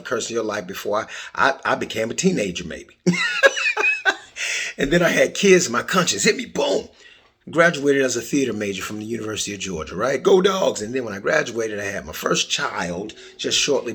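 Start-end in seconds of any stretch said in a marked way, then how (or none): 3.1-4.7 sudden high burst of laughter. Real, not acted. Host laughing at how much he cursed as a kid, high laugh. Laughs at own cursing past. 10.2-10.8 college cheer. Real, not acted.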